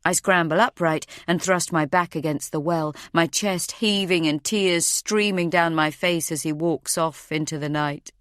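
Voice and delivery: ordinary delivery, nothing marked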